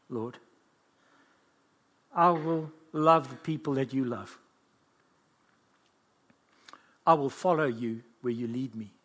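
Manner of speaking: low, measured vow to God; subdued prayer of surrender; heartfelt prayer. Acted. Prays a response to the Lord.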